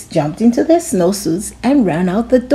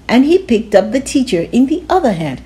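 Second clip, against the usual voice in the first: ordinary delivery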